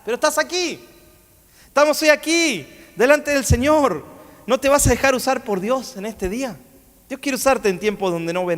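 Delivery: proclamación aguda y sostenida; prédica enérgica. Real, not acted. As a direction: exhorta a la congregación con voz elevada. Exhorta a la congregación. (predicación ferviente, en tono alto)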